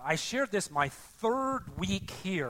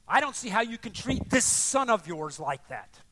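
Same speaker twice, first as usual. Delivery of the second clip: loud, higher-pitched indignant protest; vehement accusation; 4 semitones higher, 6 dB louder than usual. Acted. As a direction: confront the father as the angry elder son. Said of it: "vehement language coming out of him".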